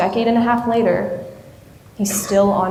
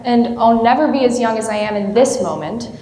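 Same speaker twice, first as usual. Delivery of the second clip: ordinary talk, nothing marked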